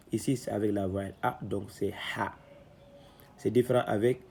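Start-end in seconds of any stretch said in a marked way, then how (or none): none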